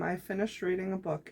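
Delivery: ordinary delivery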